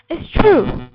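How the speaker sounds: put-on loud, high child's protest; crying out. A narrator's reading. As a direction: cry it out insistently. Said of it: "I cried".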